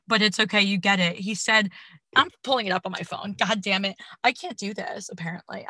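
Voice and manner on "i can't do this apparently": ordinary delivery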